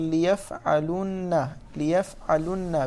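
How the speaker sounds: "ليفعلن" said slowly, each syllable spelled out; drawn out "ليفعلن" with clear endings; slow careful demo. Shown as a demonstration. Teacher showing students the form, slow and careful. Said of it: "نقول".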